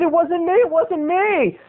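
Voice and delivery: high, pleading denial; panicked mock protest. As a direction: deny it frantically, stretching the last words. Acted protest at being accused.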